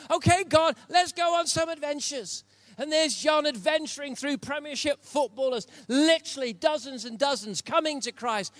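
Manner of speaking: high, loud, driving preacher voice; full-force preaching. Acted. Pastor exhorting the church at full volume. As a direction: preach it out hard to the room.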